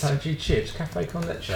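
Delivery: a tourist baffled by the local menu; English tourist. Retold or imitated. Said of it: "an English person on holiday".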